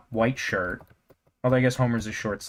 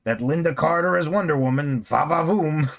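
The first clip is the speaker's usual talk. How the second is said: a little higher and louder, mock ogling dad; leering dad; 4 semitones higher, 3 dB louder than usual. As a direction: ham up an admiring dad. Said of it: "my dad often going like".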